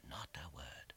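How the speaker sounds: breathless hush; hushed whisper. A narrator's reading. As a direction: whisper the silence. Narration, silence behind the door.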